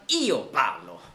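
"Io" leaned on in "Io parlo"; Italian "Io parlo" with "Io" emphasized; stress on IO. Shown as a demonstration. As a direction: give weight to "Io" in Italian. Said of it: "Aber wenn man betonen will".

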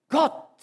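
"Gott" called out high and loud; stressed Gott. Shown as a demonstration. Preacher showing his listeners which word carries the weight, high and loud. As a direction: hit the single word "Gott" hard and high. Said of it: "das wird betont".

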